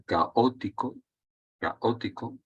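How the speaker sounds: "caótico" slowly as ca-Ó-tico; "caótico" sounded out carefully with a hiatus; vowels separated. Shown as a demonstration. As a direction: say "caótico" with A and O apart, Ó stressed. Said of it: "separamos la A y la O".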